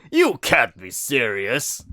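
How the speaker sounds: loud, slow, incredulous protest. A narrator's reading. Brute protests to the Grey Wolf, loud and drawn out. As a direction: object loudly, stretching the words. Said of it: "the brute protests".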